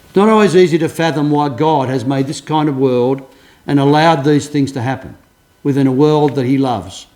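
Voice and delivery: ordinary talk, nothing marked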